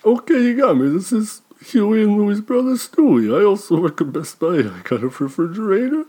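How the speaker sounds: a squeaky little brother; high squeaky voice. Acted. Plays Stewie, high voice.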